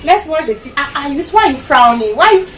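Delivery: high-pitched, hammed-up friendliness of a parent; overly friendly. Parent coaxing a hurt child instead of apologizing, overly friendly. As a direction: imitate a parent coaxing, much too friendly. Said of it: "trying to be overly friendly".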